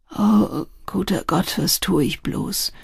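mildly despairing, trailing off; helpless. A narrator's reading. Smoker wonders what he is doing, helpless. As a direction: sigh it out helplessly. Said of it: "fuhr er hilflos fort".

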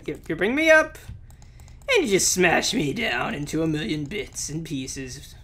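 ordinary talk

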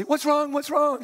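mock-innocent concern; feigned innocence. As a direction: ask it lightly, as if knowing nothing. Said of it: "the neighbor walks out innocently".

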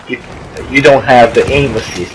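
ordinary delivery

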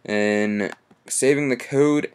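normal talking voice

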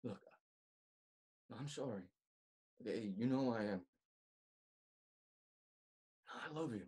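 soft and low, sincere reassurance; tender plea. Acted. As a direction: speak gently, like a brother who cares. Confessing love as a brother.

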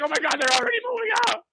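high, fast mock panic of a scared beginner; nervous newcomer. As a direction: play the frightened new student, squealing it quickly. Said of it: "they're like".

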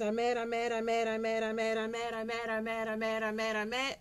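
rapid monotone chant of frustration; mock-mad repetition on one note; venting anger. Shown as a demonstration. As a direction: vent it in an unbroken, droning run. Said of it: "When I'm really angry".

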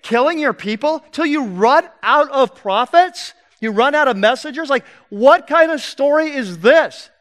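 loud, high, exasperated rant; irritated crowd member. Acted. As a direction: rant like a fed-up listener in the crowd. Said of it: "I'm getting irritated".

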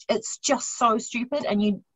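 usual speaking voice

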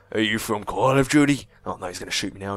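normal talking voice

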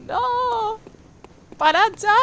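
high, drawn-out no, then loud; protesta a gritos. Real, not acted. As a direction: cry out for them to stop. Mock protest at chat's joke. (protestar gritando en agudo)

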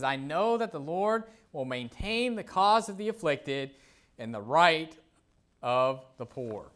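slow, solemn Bible reading; scripture reading. Acted. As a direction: give the verse weight, slowly. Reads scripture aloud.

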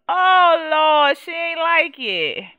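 real loud dismay; dismayed outcry. Real, not acted. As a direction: cry out in dismay at the bad news. Reacts to sim disliking house.

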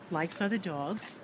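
normal talking voice

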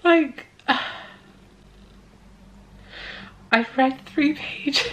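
high, slow, tearful disbelief; real crying. Real, not acted. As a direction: squeeze the words out through tears. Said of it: "let that cry out".

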